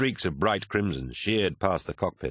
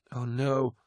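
high, distressed moan; 5 semitones higher than usual. A narrator's reading. Mercedes reeling from the vision of decay, moaning. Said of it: "Mercedes was moaning".

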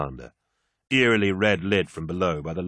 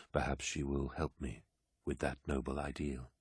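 soft, subdued reflection; musing warlord; 3 semitones lower, 7 dB quieter than usual. A narrator's reading. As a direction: muse quietly on your legacy, trailing low. Narrator voices Horus musing on legacy.

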